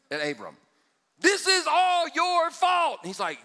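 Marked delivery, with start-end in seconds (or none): 1.2-3.0 slow, loud, high blaming yell, each word drawn out. Acted. Sarah yelling at Abram, slow high angry shout. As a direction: drag out the blame. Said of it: "so she's yelling".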